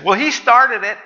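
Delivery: high mock child voice; whiny kid excuse. Pastor mimicking a kid's excuse, high and whiny. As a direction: whine it like a kid caught out.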